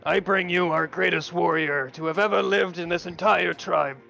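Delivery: booming chieftain's proclamation, loud and high. Acted. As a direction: call it out to the whole tribe.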